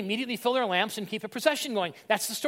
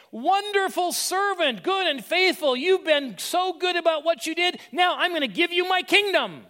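high, loud, joyful master praising his servant; 7 semitones higher, 3 dB louder than usual. Acted. Master praises faithful servant, high delighted voice.

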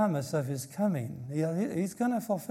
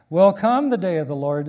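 "Will come" punched loud at the front; very emphatic; 2 semitones higher, 9 dB louder than usual. Shown as a demonstration. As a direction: hit "Will come" hard, then finish the phrase. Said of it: "you make it very emphatic".

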